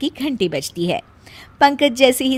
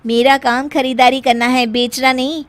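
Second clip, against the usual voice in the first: sassy bahu; 4 semitones higher, 4 dB louder than usual. Acted. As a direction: spell out her name smugly. Meena sasses mother-in-law. (सास को इतराकर जवाब देती बहू)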